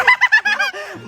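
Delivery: high burst of giggling; laughter. Acted. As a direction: cackle with glee, high-pitched. Child laughs after boasting of song.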